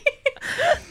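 high, breathy giggle; high laugh. Real, not acted. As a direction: burst into a laugh. Laughs at own whisper.

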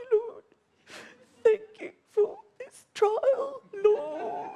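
high, slow tearful thanks; weeping prayer, voice high; crying. Retold or imitated. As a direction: squeeze it out through sobs.

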